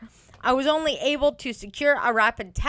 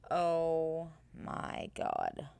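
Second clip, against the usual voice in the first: exasperated; 7 semitones lower, 10 dB quieter, much slower than usual. Real, not acted. Reacting to dumb claim.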